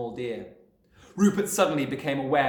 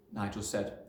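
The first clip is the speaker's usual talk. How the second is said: ordinary delivery, nothing marked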